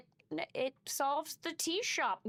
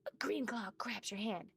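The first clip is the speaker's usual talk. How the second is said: hushed dramatic whisper; whispered cliffhanger; suspense whisper; 4 dB quieter than usual. Acted. GM suspense reveal.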